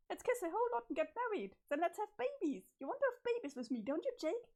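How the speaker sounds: high, bubbly and overexcited; high-pitched manic sweetness; giddy Jane voice. Acted. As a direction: gush at Jake like a lovestruck girl gone strange. Jane pushes marriage, babies.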